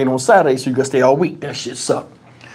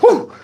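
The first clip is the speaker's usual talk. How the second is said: shocked gasp. Retold or imitated. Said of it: "He clutched his pearls".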